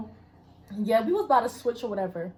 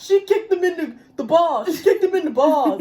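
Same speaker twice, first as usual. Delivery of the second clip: louder tattletale voice, laughing at the end; snitching classmate; 4 semitones higher, 6 dB louder than usual. Retold or imitated. Tattling classmate telling the teacher, hammed-up snitch voice. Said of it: "Larry snitching ass was like".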